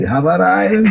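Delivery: high, loud incredulous question; mock disbelief. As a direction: play the clueless friend. Friend voiced in disbelief.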